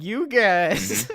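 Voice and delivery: loud, drawn-out mock complaint; imitated outburst. Friend predicting John's reaction once they end, loud mock whine. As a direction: call out a mock protest loudly. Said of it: "he's gonna be like".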